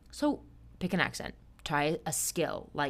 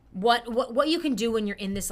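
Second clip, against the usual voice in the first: ordinary talk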